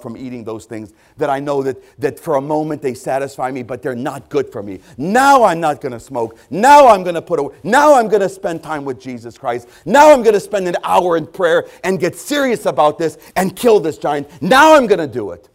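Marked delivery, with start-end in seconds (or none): 5.0-15.5 loud, driving preacher's cadence, punching each Now; preaching at full force. Acted. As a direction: hammer every Now like a revival preacher. Preacher thundering repeated vows.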